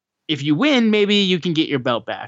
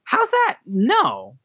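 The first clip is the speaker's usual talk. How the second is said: mocking high Jay voice; 11 semitones higher, much slower than usual. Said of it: "And Jay's like".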